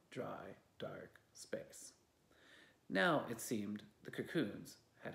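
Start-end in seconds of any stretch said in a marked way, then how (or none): 0.1-1.9 slow, soft whisper. A narrator's reading. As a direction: breathe it out slowly. Narrator whispers softly.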